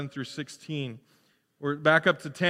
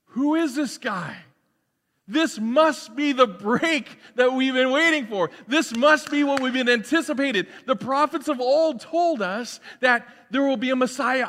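higher, eager voice as the people wonder; hopeful crowd; 8 semitones higher, 4 dB louder than usual. Acted. The crowd wonders if John is the one, higher and eager. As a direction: lift it with rising hope for the Messiah. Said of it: "and they think".

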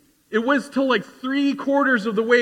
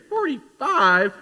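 higher, drawn-out mock disbelief; mock incredulous; 5 semitones higher, slower than usual. Listeners hearing the preacher's age, mock incredulous. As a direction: stretch it out, scoffing at the number.

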